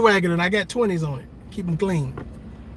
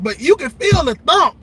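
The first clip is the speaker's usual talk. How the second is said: emphatic; 8 semitones higher, 5 dB louder than usual. Real, not acted. Stressing the impact.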